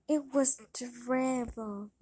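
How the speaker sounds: ordinary delivery, nothing marked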